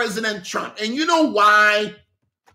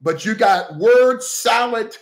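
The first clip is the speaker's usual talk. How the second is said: normal talking voice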